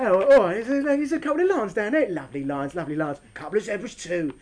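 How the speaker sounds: put-on Cockney accent, dropping low; Cockney elephant. Elephant spotting lions and zebras to another, Cockney accent. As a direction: play an elephant chatting in Cockney. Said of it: "Thankfully, they're not Cockney".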